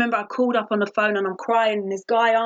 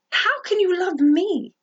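higher, drawn-out disbelieving question; incredulous; 7 semitones higher, slower than usual. Retold or imitated. Speaker answering people who said they loved her, incredulous. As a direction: ask it slowly, pitched up in disbelief.

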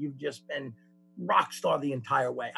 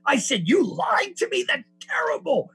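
higher, louder, hammed-up appalled rant; mock outrage; 8 semitones higher, 5 dB louder than usual. Mocking his own hypocrisy, hammed-up appalled rant. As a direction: play up the self-righteous indignation.

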